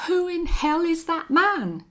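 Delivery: loud, high furious yell. A narrator's reading. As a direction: shout it out to the four winds. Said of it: "yelled the rustler".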